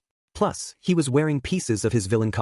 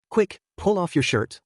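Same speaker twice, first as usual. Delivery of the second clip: ordering; 7 semitones higher than usual. A narrator's reading. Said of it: "I ordered".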